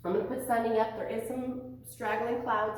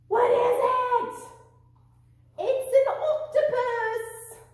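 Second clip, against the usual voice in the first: loud, high, slow shout of delight; excited reveal; 14 semitones higher, 8 dB louder, slower than usual. Acted. Teacher revealing the creature of the day to preschoolers, excited shout. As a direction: announce it like a big surprise.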